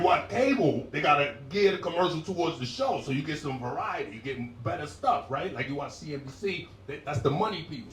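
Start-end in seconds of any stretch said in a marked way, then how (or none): none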